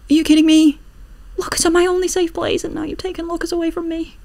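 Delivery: loud, high outraged protest. Real, not acted. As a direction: complain loudly, shrill.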